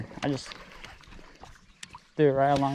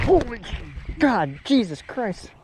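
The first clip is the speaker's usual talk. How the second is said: startled exclamation; 8 semitones higher, slower than usual. Real, not acted. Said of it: "That scared the crap out of me".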